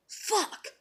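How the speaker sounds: breath-only expletive; whispered curse. Retold or imitated. Cursing inwardly at work, whispered. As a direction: whisper it as if inside your head. Silent curse inside her head.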